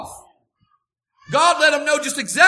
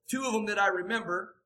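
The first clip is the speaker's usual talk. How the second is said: ordinary delivery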